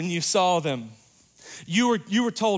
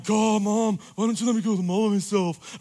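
mock whiny teenager, a bit higher; whiny teen; 5 semitones higher, 3 dB louder than usual. Teen at the mall, whining at mom. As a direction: play the embarrassed teen groaning at mom. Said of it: "they're going".

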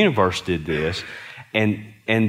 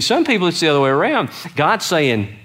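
ordinary delivery

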